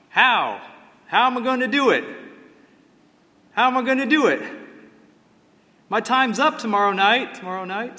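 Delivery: high, loud anguished cry that fades as it echoes away; crying out in despair. Retold or imitated. Preacher acting Alvin York's cry of despair in the field, high and loud, fading out. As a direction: cry out to the sky, then let the last words echo off. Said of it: "he cries out".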